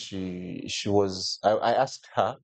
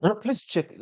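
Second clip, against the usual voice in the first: pleading; 12 semitones higher than usual. Retold or imitated. Begging agent to recheck flight.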